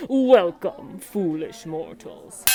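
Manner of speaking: slow, spooky welcome; ghost host voice. Shown as a demonstration. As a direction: drag out foolish mortals like a ghost host. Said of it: "imitate the ghost hostess".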